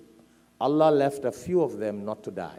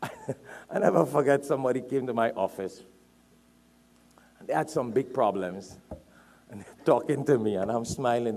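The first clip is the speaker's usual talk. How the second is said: normal talking voice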